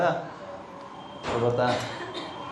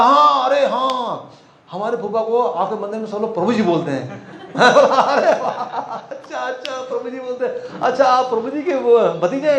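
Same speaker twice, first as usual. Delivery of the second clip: excited exclamation; 9 semitones higher, slower than usual. Retold or imitated. Retelling a stranger's delighted recognition of the uncle's title. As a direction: voice jumps high and drawn out in delighted recognition.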